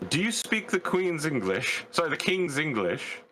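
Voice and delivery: high, hammed-up Southeast London voice; fake English accent. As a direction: put on a cheeky London accent. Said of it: "full on faking an English accent".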